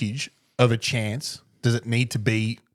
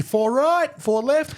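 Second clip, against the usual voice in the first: calling four; 13 semitones higher, slower than usual. Retold or imitated. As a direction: sing out the direction like a call across the course. Explaining golf warning call.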